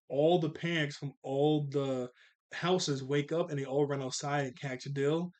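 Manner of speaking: ordinary talk, nothing marked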